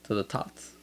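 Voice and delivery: put-on toast in a Mexican accent. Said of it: "that's my Mexican accent".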